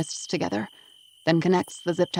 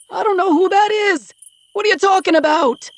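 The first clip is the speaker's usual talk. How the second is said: very high, loud frantic denial; 14 semitones higher, 9 dB louder than usual. Acted. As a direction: deny everything in a shrill panic. Man denies knowing his victim.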